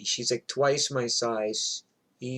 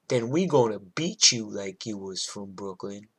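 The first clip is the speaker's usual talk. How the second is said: lower-pitched threat after the question; threatening punchline; 4 semitones lower than usual. Retold or imitated. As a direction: deliver the neighbor's threat, voice dropped.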